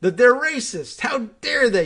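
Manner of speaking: hammed-up indignation, soaring high on parents; mock outraged demagogue. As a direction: sneer and howl like a fake-outraged rabble-rouser. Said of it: "And then you can say".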